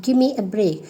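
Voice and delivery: "Give me" slurred into gimme; shortened form. Shown as a demonstration. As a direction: drop the v in "Give me". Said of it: "you can say".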